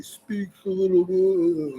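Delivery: slow, high-pitched imitation of impaired speech; imitated impaired speech. Storyteller mimicking his brain-injured friend, slow impaired speech. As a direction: drag the words out high, imitating labored speech. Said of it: "you know, gimpy or whatever".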